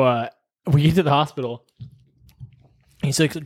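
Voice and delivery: ordinary talk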